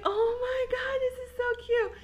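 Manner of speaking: high excited squeal; gushing squeal. Retold or imitated. Girlfriend thrilled by her boyfriend's gift, high gushing squeal. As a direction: squeal over a tiny cute gift. Retold reaction to guinea pig gift.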